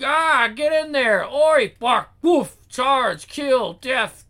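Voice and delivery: loud, high battle cries; attacking gang yells. Acted. Gang suddenly charging in, loud high shouts. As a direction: bellow each word as a charge.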